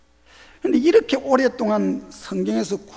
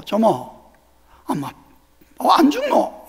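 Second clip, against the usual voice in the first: Geoje dialect teasing. Retold or imitated. Grandchild teasing grandmother in Geoje dialect. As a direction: playfully ask grandma in dialect. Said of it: "제가 거제도 사람입니다".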